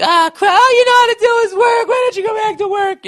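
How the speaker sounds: very high, loud mock nagging screech; shrieking wife. Mocking the wife yelling at her husband, high loud screech. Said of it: "if she said it like that".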